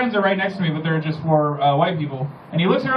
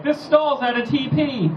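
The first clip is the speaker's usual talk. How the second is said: higher, slow, giggly mock lady's voice; mock woman's voice; 7 semitones higher, slower than usual. Woman in a restroom asking for toilet paper, mocked high. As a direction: lift it into a sweet mocking lady. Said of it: "it's like".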